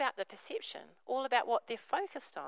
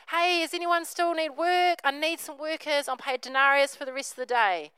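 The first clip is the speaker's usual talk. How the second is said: loud, higher call for workers; 6 semitones higher, 8 dB louder than usual. Retold or imitated. Vineyard owner calling out for more workers, loud and high. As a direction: shout it across the vineyard.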